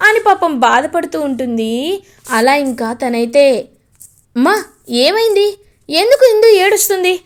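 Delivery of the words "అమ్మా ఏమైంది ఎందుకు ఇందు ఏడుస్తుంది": alarmed asking. Acted. Gagan asks mother why sister cries.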